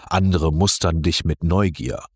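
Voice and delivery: ordinary delivery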